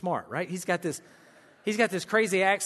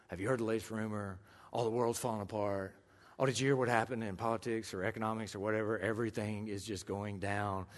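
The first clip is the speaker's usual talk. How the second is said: gloomy gossip; 8 semitones lower, 7 dB quieter than usual. Retold or imitated. Imitating gossip.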